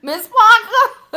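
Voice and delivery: loud high call, laughing through it; shouting Miss Parker between laughs. Real, not acted. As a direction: holler her name while cracking up. Calling out, laughing.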